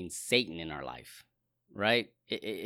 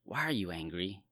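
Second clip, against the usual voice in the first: soft, low, caring question; quiet compassion; gentle, loving; 3 semitones lower, 5 dB quieter than usual. Shown as a demonstration. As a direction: ask it tenderly, like a concerned father. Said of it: "It sounds loving".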